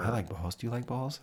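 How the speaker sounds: soft-spoken host parody; softly, with mock-innocent politeness; mock hushed talk. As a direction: imitate a hushed radio chat. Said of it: "they talk like this".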